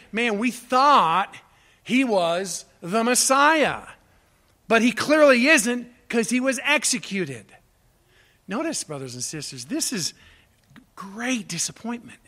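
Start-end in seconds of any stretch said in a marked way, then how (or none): 0.1-7.4 disillusioned lament. Retold or imitated. Preacher voices the disillusioned disciples.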